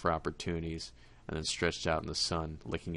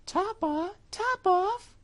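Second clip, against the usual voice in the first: very high, louder astonished mouse; mouse crying out; 7 dB louder, much slower than usual. Acted. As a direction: squeak the name back in disbelief. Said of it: "cried the mouse".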